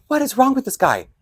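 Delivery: high-pitched incredulous aside; baffled exasperation. Retold or imitated. Thinking about the yelling man, exasperated. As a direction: wonder at him, voice jumping up.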